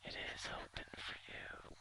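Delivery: very soft eerie whisper from the fog; faint whispers. Acted. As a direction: whisper it faintly, luring them in. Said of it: "you hear faint whispers in the fog say".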